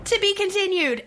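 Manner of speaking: high, loud cliffhanger call; dramatic announcer. Pretend. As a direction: boom it like a trailer voice. Host repeats cliffhanger announcement.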